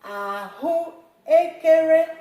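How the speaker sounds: Ahụekere said slowly and loud, tone high; teacher repeating Ahụekere clearly; slow Igbo word. Shown as a demonstration. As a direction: spell out Ahụekere slowly for the class. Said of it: "I'll repeat this one".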